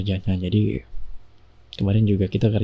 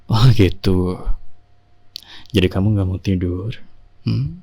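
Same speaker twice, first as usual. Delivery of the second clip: mock stern; 2 semitones lower than usual. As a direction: pretend to scold her into sleep, half joking. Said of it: "Ini Mas serius loh". (suami pura-pura galak menyuruh istri tidur, nada rendah berbisik)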